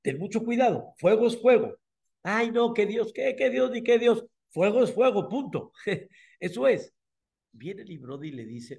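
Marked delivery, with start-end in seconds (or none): 0.0-6.9 mocking emperor. Nimrod mocks believers, higher pitched and scornful. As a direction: sneer at belief in God.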